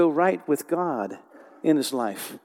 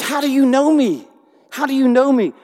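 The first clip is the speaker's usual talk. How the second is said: high, loud astonished cry; totally shocked; 10 semitones higher, 10 dB louder than usual. Retold or imitated. Nathanael stunned that Jesus knows him, high shocked cry. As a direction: gasp it out in disbelief. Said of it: "totally shocked".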